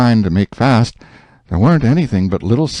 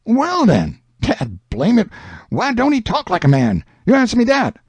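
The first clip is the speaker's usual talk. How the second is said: put-on higher boyish Huck, exasperated; 12 semitones higher than usual. Huck pushes Jim to answer, high exasperated voice. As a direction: Huck throws up his hands, voice high.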